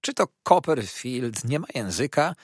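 plain reading voice

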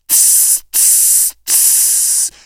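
voiceless "ts" hisses; creature's hiss. Acted. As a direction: imitate hissing sea creatures, one short burst each. Głosy stworzeń z morza. (syczenie morskich stworów)

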